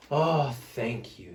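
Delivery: slow, sighing thanks; grateful sigh. Acted. Smelly Bear thanks Fluffy Bear, slow sighing. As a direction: sigh your thanks gently. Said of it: "Big Smelly Bear sighed".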